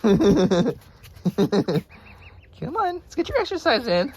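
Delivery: high sing-song coaxing. Real, not acted. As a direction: coax it up high.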